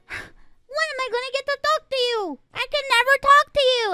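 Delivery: high, whiny nagging mother's voice; nagging mom. Retold or imitated. Mom pestering her streaming daughter, high whiny nag. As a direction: whine like a mom who never gets to talk.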